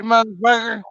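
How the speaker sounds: ordinary talk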